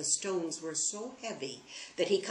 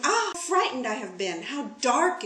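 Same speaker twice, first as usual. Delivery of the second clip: relieved cry; 7 semitones higher, 5 dB louder than usual. Acted.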